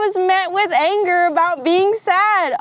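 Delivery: real surprised insight; sudden realization. Real, not acted. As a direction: blurt it out as it dawns on you. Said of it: "Oh my gosh, you guys, see?".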